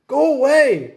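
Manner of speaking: high, loud shout; high loud brush-off; crying out. A narrator's reading. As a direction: shove off the pesky girl. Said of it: "cried Froggy".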